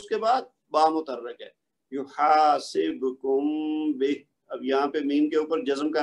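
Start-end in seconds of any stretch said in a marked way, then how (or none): 1.9-4.1 very slow یحاسبکم, meem hummed before a short بہ; tajweed recitation. Shown as a demonstration. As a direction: recite for repeating, stretch یحاسبکم and its nasal meem into بہ. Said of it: "ان کو one by one سب کو پڑھتا ہوں".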